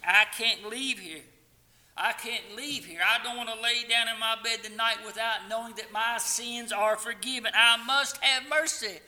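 high, loud begging, building to the end; desperate retold plea. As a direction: cry out for mercy in the young man's voice. Young man's desperate plea retold.

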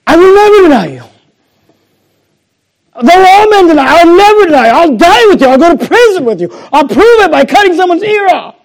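loud, high, insistent declaration; hammed-up, over-eager shouting; impassioned shouted vow. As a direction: proclaim undying loyalty at the top of your voice. Acting out Peter's earlier vow.

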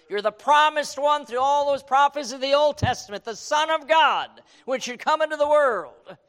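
impassioned plea. Acted. Preacher voicing Martha's plea.

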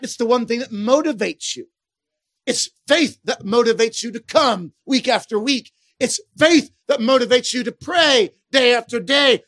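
normal talking voice

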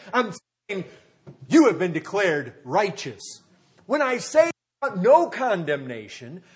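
loud, emphatic sermon delivery; punchy preacher hammering the key words; forceful preaching. Real, not acted. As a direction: drive each point home loudly to the congregation.

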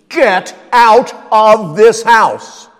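slow, loud, booming command to leave; shouted order. Pretend. Pastor ordering intruders out, loud slow shout. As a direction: throw them out of the house, slowly and loudly.

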